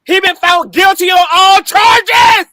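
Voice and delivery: slow, loud, high triumphant announcement; excited shout. Real, not acted. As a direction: shout the big news. Breaking news verdict announced.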